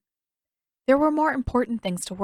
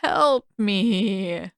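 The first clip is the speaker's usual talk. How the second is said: whining; 5 dB louder than usual. Acted. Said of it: "he whined".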